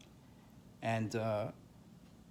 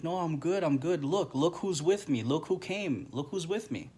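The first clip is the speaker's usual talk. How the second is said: higher-pitched, insistent retold reassurance; eager reassurance; 6 semitones higher than usual. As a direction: voice him eagerly insisting all is fine. Voicing husband's spirit reassuring her.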